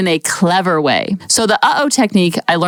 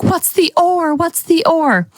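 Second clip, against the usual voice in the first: curious audience; 8 semitones higher, slower than usual. Retold or imitated. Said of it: "now that everyone's like".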